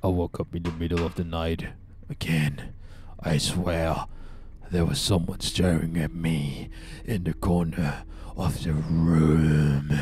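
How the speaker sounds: low, hushed spooky diary reading; reading diary aloud. Acted. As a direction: read it low like a creepy entry. Reads diary entry.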